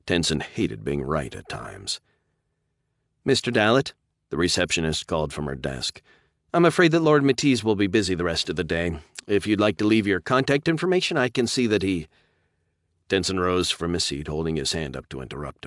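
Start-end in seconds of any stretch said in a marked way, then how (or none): 3.3-3.9 raised, louder call across the lobby; receptionist calling. Acted. Receptionist calls from desk. 6.5-12.1 raised, formal brush-off; polite receptionist. Acted.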